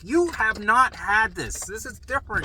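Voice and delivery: high, slow emphatic objection; heated protest. Retold or imitated. Son telling mom his case differs, heated protest. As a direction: argue back in acted exasperation. Son arguing back with mother.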